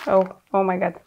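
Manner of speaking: usual speaking voice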